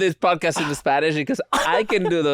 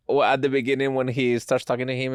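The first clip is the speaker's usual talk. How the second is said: ordinary talk, nothing marked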